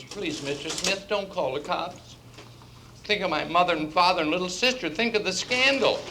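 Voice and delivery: high-voiced young woman begging; pleading secretary. Secretary caught stealing begs her boss, pleading. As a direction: plead in a high voice, drawing out the opening.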